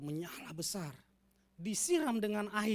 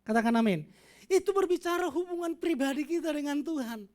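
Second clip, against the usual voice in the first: loud, high, forceful preaching; 8 semitones higher, 8 dB louder than usual. Acted. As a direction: drive the point home from the pulpit.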